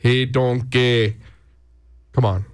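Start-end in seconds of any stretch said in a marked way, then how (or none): 0.0-1.2 drawn-out Scottish Shrek voice; slow, cartoonish Scottish burr; Scottish accent. Shown as a demonstration. Host defending his Shrek line, hammed-up Scottish accent. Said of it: "go full Scottish on Shrek".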